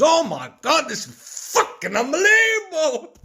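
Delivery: imitating a man screaming in ecstatic disbelief; very high, loud, full-out re-enacted yell; top-of-lungs scream. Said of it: "he was screaming through the top of his lungs".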